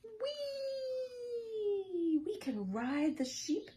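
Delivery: very high joyful whee; high squealing delighted chick; gleeful squeal. Acted. Peep squealing with joy.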